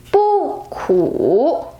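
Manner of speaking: "Bu" falls, then "ku" dips and rises; unchanged fourth tone. Shown as a demonstration. Teacher showing bu stays the same: falling "Bu", dipping "ku". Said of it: "the word bu stays the same tone".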